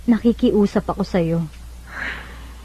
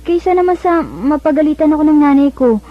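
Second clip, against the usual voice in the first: pressing urgently; 6 semitones higher, 4 dB louder than usual. Acted. Ivy pressing Vincent.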